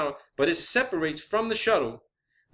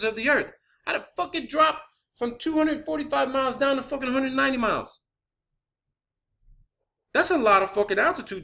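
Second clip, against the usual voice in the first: higher-pitched, worked-up disbelief; incredulous ranting; 6 semitones higher than usual. Real, not acted. Skeptic challenging the space station's altitude drop, incredulous. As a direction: scoff in a raised pitch, openly disbelieving.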